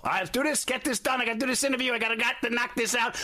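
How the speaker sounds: high-pitched rapid-fire self-orders; a busy actor rattling off his day like a field general. Retold or imitated. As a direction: bark out the day's to-do list like a field general. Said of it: "I'm like a field general".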